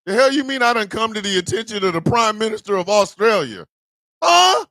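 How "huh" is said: high, loud startled huh. Real, not acted. Streamer hearing something wild, startled high huh. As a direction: jerk back in surprise, a sharp high huh. Reacting to what was said.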